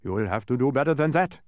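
sternly reproving dodecahedron; scolding. A narrator's reading. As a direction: scold a pupil who has failed. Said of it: "scolded the dodecahedron".

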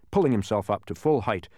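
plain reading voice

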